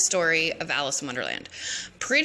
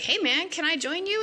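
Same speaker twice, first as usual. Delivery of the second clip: put-on much higher laid-back girly ask; high stoner Alice; 11 semitones higher than usual. Alice asking the caterpillar to join, high and chill. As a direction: chirp it like a stoned teen. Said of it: "She's like".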